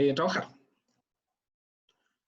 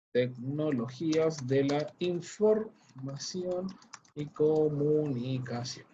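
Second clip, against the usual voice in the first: very slow, drawn-out syllables, slightly lower; typing aloud; 4 semitones lower, 4 dB quieter, much slower than usual. Real, not acted. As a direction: say it piece by piece as you type. Typing the title while saying it.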